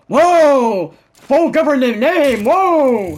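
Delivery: loud, high, hammed-up scream of alarm. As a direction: yell it out in mock shock. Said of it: "I just start screaming".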